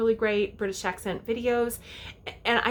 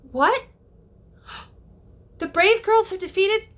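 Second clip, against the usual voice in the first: high gasping disbelief; gasp, then ask in shock; shocked reaction; 10 semitones higher, 5 dB louder than usual. Shown as a demonstration. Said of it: "because he says".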